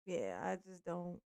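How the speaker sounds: usual speaking voice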